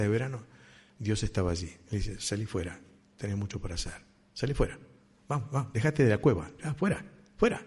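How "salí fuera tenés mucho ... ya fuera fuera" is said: soft, gentle urging, much softer; tender coaxing. Acted. God gently calling Elijah out of the cave, soft and tender. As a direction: coax him out of the cave kindly. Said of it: "Dios nos trata con mucho cariño".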